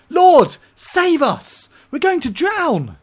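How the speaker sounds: high, loud, drawn-out cry for rescue; terrified shout. Retold or imitated. Preacher voicing the terrified disciples' slow, loud cry. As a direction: shout it like men about to drown. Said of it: "I'm sure they shouted it".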